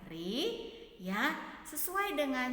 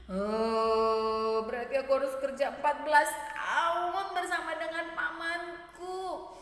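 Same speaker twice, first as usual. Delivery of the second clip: dismayed Jacob voice; 3 semitones higher, 4 dB louder than usual. Acted. Jacob dismayed at fourteen years.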